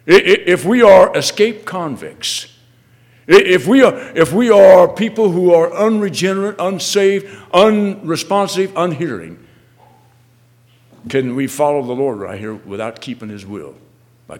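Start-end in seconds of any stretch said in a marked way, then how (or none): 0.1-9.4 loud, high, hammered list; impassioned preaching. Real, not acted. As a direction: drive each word at the congregation. Preacher lists the sinners.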